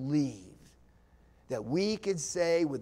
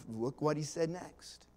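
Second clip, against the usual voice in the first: ordinary talk, nothing marked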